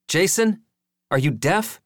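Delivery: high, rising exasperated call. A narrator's reading. Joey badgers distracted Jason.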